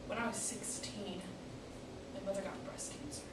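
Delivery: subdued and halting; grieving daughter. Acted. Monologue, recalling mother's illness.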